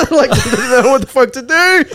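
high, laughing mock wail; mock near-tears panic. Lost passenger retelling four hours stranded, mock near-tears. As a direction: play helpless panic through laughter. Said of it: "on the verge of tears".

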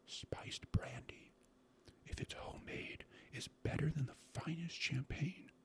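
slow, savoring whisper; hushed, drawn-out rapture; scarcely audible whisper. A narrator's reading. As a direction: whisper it like a delicious secret. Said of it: "he went on in a scarcely audible whisper".

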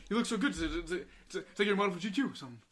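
hammed-up rushed compliment; goofy friend voice. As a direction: gush like a big goofy kid. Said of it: "He was like".

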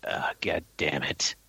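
low, slow imitated exasperation; inward sigh. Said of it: "inside mentally sighing, going".

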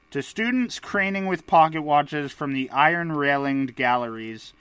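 normal talking voice

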